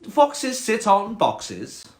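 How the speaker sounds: high, very slow snobby cat. Acted. Cat schooling the frog, snobby and slow. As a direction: draw out the rule snootily. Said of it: "quite a snobby cat".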